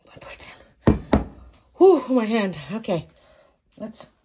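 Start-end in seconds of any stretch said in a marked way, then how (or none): 0.1-1.3 whispered aside; whispering. Real, not acted. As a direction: whisper it under your breath. Aside off-camera while working.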